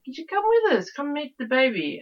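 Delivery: put-on high, loud, hammy Italian accent; bad Italian accent. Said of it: "that was a terrible Italian accent".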